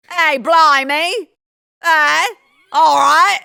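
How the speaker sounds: loud hammed-up Cockney; mock British. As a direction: ham up a British blimey. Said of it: "I'm gonna do that from now on".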